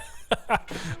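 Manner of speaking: breathless little laugh. Real, not acted. Caster apologising to European friends, laughing it off. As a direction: laugh off your own apology.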